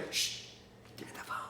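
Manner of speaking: hushed unvoiced whisper. Whispering to the salesman mid-call. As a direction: whisper so the customer won't hear.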